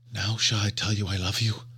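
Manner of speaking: usual speaking voice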